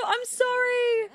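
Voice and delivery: high, whiny mock apology to the game character; squeaky apology. Real, not acted. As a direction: plead sorry in a tiny high voice. Apologizing to Sim Dawn.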